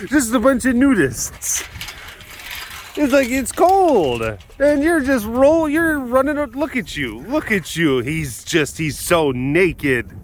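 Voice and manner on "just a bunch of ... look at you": high-pitched playful exclaiming; amused teasing. Pretend. Keeper teasing naked hooligans running about in the cold, high amused squeal. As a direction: squeal it in mock disbelief.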